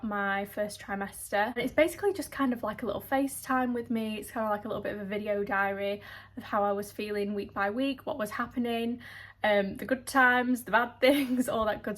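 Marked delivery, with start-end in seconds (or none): none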